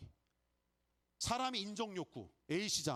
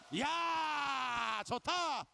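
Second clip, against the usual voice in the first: excited cheer; 9 semitones higher, 4 dB louder, much slower than usual. Real, not acted. Crowd applause cheer.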